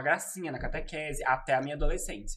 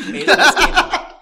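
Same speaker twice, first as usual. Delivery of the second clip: laughing; 12 semitones higher, 17 dB louder, much slower than usual. Real, not acted. Rindo depois da piada.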